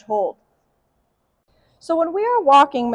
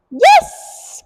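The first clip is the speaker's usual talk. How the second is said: very high, loud bright yes; high marker yes; 9 dB louder than usual. Real, not acted. Trainer marking the dog's sit, high bright yes. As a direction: praise the dog with a sharp high yes. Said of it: "should be nice and high pitched".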